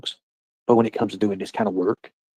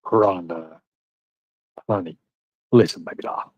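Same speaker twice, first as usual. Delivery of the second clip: a smooth, sweet-talking lower voice; coaxing ladies' man, laying it on a bit; grooming voice; 3 semitones lower than usual. Pretend. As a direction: lay on the charm to win her over. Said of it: "I'm using my grooming voice right now".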